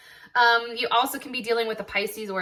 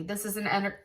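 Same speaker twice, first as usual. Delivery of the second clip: talking through the hiccups; words cut by a hiccup; 6 dB quieter, faster than usual. Real, not acted. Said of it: "sorry I have the hiccups".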